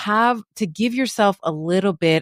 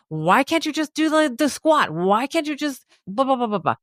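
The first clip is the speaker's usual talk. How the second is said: higher, nagging self-critic; the inner voice berating you, pitched higher; 8 semitones higher than usual. Acted. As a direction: nag yourself from inside your head. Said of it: "that voice is in our own heads berating us".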